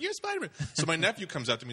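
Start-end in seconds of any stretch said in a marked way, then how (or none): 0.0-0.5 put-on high child voice, hushed in wonder; a little kid staring up at his hero; much higher kid voice; awed kid.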